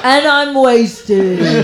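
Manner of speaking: very slow, hammed-up British accent. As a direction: drag the words out in comic British. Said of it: "amazing British accent I've ever heard".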